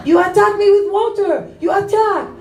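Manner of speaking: high-pitched mock indignation; hammed-up outraged cry; outraged accusation. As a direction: play the soaked man wailing his accusation. Acted angry man accusing her.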